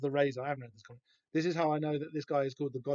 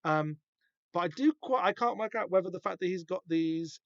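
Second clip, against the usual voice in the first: ordinary talk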